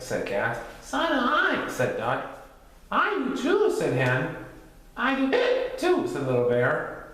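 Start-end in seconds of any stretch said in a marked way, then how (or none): 0.8-1.7 high-pitched little Duck; high, perky animal character. A narrator's reading. 2.9-3.7 very high-pitched hen; high storybook hen; Hen character voice. A narrator's reading. 5.0-6.1 high little bear with hiccups; hiccupy cub voice; hiccuping. A narrator's reading. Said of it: "You have the hiccups".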